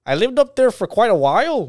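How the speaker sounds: high, loud Upper Midwest lilt; North Dakota accent. Pretend. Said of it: "North Dakota accent".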